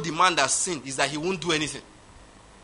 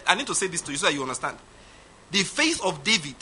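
normal talking voice

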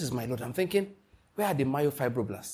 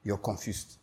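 low mock muttering; 7 semitones lower, 8 dB quieter than usual. Preacher teasing his congregation, low quiet mutter. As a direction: drop low and quiet, mock a confused man. Preacher mocking a confused listener.

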